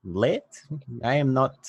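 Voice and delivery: lightly voiced Russian accent; a hint of a Russian accent.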